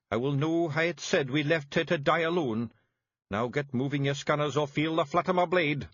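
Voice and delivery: high Scottish, pressing and gruff; Feegle chief urging. A narrator's reading. Rob Anybody drives the Feegles off on the raid, urgent Scottish chief. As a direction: hurry them off with a threat.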